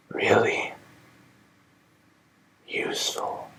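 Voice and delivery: very soft, faint whisper; dying friend's final words. Acted. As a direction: let it fade out.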